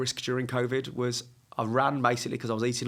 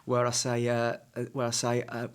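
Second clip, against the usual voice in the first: ordinary talk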